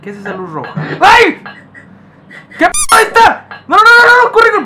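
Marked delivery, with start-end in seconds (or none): 1.0-1.4 high, loud startled yelp; sudden sharp cry of fright. Real, not acted. Player startled by a red light, loud yelp. 2.6-4.6 very high, loud panicked yelling; panicked screaming. Real, not acted. Player spotting the creature and fleeing, screamed in panic.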